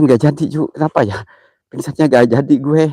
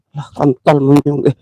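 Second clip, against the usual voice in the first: usual speaking voice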